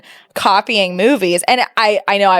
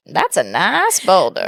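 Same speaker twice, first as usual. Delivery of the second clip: slow, drawn-out cartoon sidekick voice; lower, lazy drawl on a film line; Donkey; 4 semitones lower, slower than usual. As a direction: stretch the vowels in a movie-quote impression.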